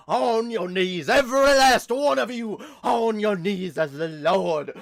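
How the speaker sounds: loud, high preacher command; preaching at full force. Pretend. Resumes faith healer voice.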